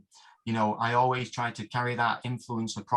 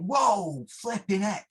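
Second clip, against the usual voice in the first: shocked exclamation; 10 semitones higher, much slower than usual. Retold or imitated. Shaken by Lance's hard punches in sparring, high startled whoa. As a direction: reel back from a punch.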